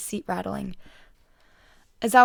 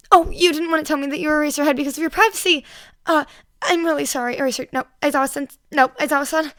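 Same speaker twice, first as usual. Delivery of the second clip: flustered apology; 6 semitones higher, 7 dB louder than usual. A narrator's reading.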